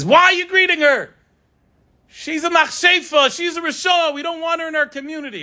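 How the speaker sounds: put-on high shrieking accusation from an old neighbour; screaming bloody murder. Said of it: "screaming bloody murder".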